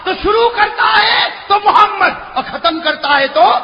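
high, loud sermon cry; fiery preaching. Acted. Preacher stirring the crowd, fiery preaching. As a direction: preach it out with fervour.

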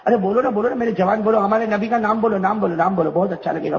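usual speaking voice

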